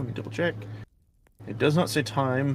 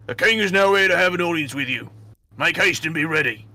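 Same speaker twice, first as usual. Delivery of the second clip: guard's announcement; 5 semitones higher, 4 dB louder than usual. Acted. GM voices a guard at door.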